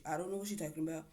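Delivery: quick, soft flustered voice; mock nervous denial. Mike denying a girlfriend, fast soft flustered denial. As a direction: stammer it out like caught lying. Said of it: "was like".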